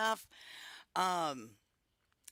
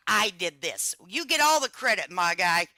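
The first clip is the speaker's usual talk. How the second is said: louder, higher, hyping up the creator; emphatic shout-out; 5 semitones higher, 8 dB louder than usual. Real, not acted. Streamer to the video's creator, a loud, high hype shout-out. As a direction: call it out like a big shout-out. Loudly crediting the video's maker.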